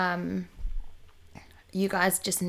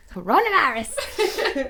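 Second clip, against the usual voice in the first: laughing it out, slow and higher; 7 semitones higher, 4 dB louder, slower than usual. Real, not acted. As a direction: burst out laughing on the word. Laughing repeat of partner's joke.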